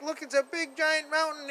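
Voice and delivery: ordinary delivery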